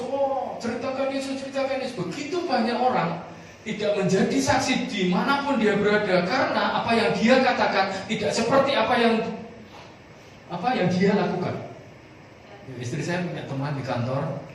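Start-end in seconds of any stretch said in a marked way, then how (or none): 0.0-2.0 lightly mocking, high, sing-song piety; mocking pious voice. 2.0-11.6 high, loud, driving preacher's voice; fervent preaching. Acted. Preaching at full force.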